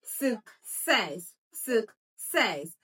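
"success" split as suk, SESS, falling on SESS; stress on second syllable. Shown as a demonstration. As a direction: break "success" in two, stressing the second part. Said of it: "You would notice something".

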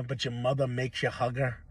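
ordinary talk

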